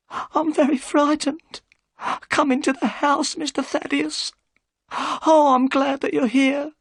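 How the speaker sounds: put-on trembling old housekeeper; frightened housekeeper. A narrator's reading. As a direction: plead with relief and fear.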